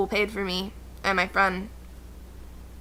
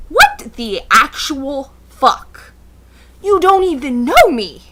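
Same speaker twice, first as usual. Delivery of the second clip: loud, very slow, outraged; 3 semitones higher, 9 dB louder, slower than usual. Retold or imitated. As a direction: spell out every word in outrage. Outraged at the question.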